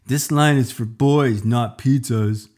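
loud, rough bully taunt; mean shout. Acted. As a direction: shove him off with a bark. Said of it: "pushed me away and said".

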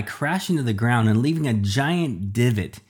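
ordinary delivery, nothing marked

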